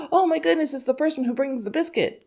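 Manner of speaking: high-pitched gasp and gush over the visitor; delighted squeal of a dog spotting the biscuit person; excited gasp. Retold or imitated. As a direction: voice the dog thrilled at the doorbell, pitch way up. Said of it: "a very excited".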